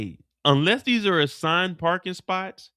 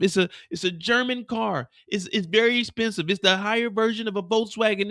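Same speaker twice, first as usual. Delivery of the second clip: higher, panicky begging; scared pleading; 5 semitones higher than usual. Acted. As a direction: plead for your life, talking fast to stall. Mireya begging not to be shot.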